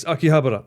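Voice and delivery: Akihabara with short, quick ba-ra; confirmed pronunciation. Shown as a demonstration. Streamer confirming it to chat, Akihabara with short ba-ra. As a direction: say Akihabara as aki-HA-ba-ra. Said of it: "The way you say it".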